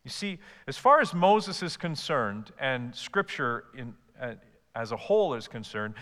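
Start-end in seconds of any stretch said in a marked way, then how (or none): none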